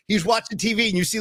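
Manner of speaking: normal talking voice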